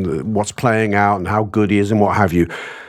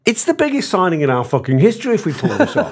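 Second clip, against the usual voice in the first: excited; 8 semitones higher than usual. Real, not acted. Excited about the big signing.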